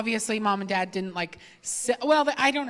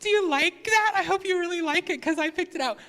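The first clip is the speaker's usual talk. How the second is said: high, eager lady hoping you love it; sweet gift-giver; 7 semitones higher than usual. Retold or imitated. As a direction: imitate a keen helper, pitched up. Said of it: "the lady who bought it for me was like".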